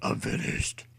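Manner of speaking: hushed, breathy despairing thought; inner thought. A narrator's reading. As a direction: murmur it to yourself in defeat. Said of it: "he thought".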